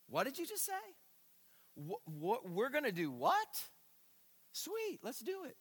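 high, thrilled disciples jumping at the offer; an eager yes, pitched high. Retold or imitated. Disciples answering Jesus, high and eager. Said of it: "They gotta be thinking this".

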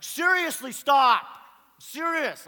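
very loud, high exasperated shouting; agitated yell. Retold or imitated. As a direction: yell it at the end of your patience. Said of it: "I'm getting agitated".

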